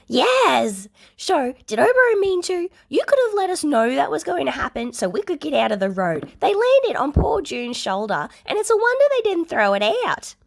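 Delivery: loud, high, exasperated phone rant; shouting down the phone. A narrator's reading. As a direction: yell at him for dumping bags on you. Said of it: "Hisashi's screams".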